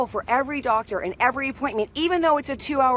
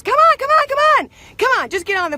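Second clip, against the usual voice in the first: much higher, loud "come on"; rushing her onto the plane before the door locks; urgent hurry-up shout; 13 semitones higher, 8 dB louder, faster than usual. Retold or imitated. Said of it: "and she goes".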